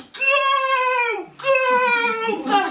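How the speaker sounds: long, high, roared shout. Acted. Bear sending the woman away, shouting. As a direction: bellow it as the bear. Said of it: "And he shouts".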